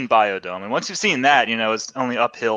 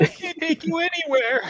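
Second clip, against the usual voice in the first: high, exasperated wife impression; a wife scolding her embarrassing husband, high and exasperated; high mock wife voice; 4 dB quieter than usual. Husband imitating his fed-up wife, high and mocking.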